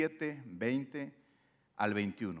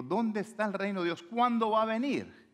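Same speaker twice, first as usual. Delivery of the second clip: pressing Pharisees; 6 semitones higher than usual. Retold or imitated. Voicing the Pharisees' demand.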